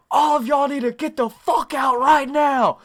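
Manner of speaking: high, loud yell of an angry mom; angry mom shouting. Retold or imitated. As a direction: bellow it like a mother throwing kids out. Angry mom bursts into the room.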